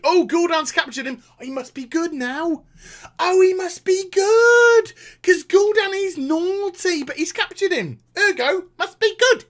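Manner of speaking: high, mock-naive reasoning; sarcastic mimicry. Fan mocking the redemption plot, sarcastic and high.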